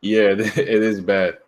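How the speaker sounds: slow, laughing reply. Real, not acted. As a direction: laugh through the words. Laughing at bad connection.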